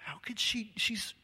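hushed indignant whisper; irritated whisper. Retold or imitated. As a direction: whisper it like a scandal. Group irritated at long shower.

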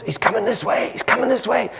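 high, urgent murmur through a crowd; excited rumour. Acted. Rumour spreading that Jesus is coming, excited. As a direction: pass the news on, high and eager. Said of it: "the rumor comes".

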